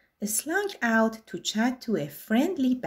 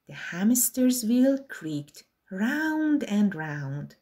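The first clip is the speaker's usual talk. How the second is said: ordinary delivery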